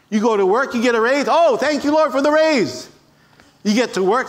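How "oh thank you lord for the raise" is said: high, elated prayer of gratitude; excited, raised-pitch thankfulness; joyful thanks. Acted. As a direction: burst out thanking God, acted. Acted thanks for a pay raise.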